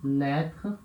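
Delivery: lettre said with an open e, final re barely heard; lettre, quick, open e, swallow the end; French lettre. Shown as a demonstration. Teacher gives the French word for letter. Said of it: "em francês é".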